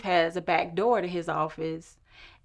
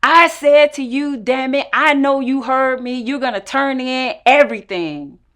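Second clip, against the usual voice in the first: much higher, loud angry shouting; furious yell; 8 semitones higher, 14 dB louder than usual. Retold or imitated. Director yelling at her to turn things in, furious shout. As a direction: bellow the order at her. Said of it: "Then he begins to yell and turn red".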